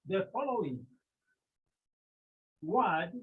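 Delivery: ordinary delivery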